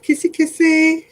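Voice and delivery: high, slow, cooing kissy kissy; playful kissy voice. Real, not acted. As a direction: coo it like blowing kisses to a viewer. Blowing kisses to Trev.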